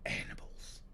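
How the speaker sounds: whispered, voiceless complaint; disgusted whisper. Acted. Engineer complaining about bathhouse noises, whispered. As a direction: hiss the complaint under breath.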